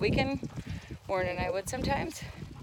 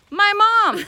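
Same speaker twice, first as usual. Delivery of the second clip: loud punchline; 10 semitones higher, 13 dB louder than usual. Real, not acted. Punchline, mom has vanished.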